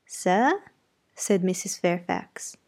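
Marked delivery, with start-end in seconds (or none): 0.1-0.6 bewildered sir. Acted. Widow bewildered.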